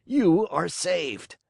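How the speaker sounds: slow booming showman voice; gruff puppet master. Acted. To Pinocchio, a slow booming pardon. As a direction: Fire Eater sparing the puppet, slowly. Fire Eater speaking.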